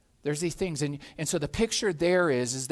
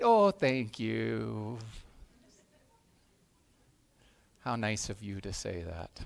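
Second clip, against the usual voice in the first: demure host; 7 semitones lower, 5 dB quieter, slower than usual. Retold or imitated. Host answering guest's compliment.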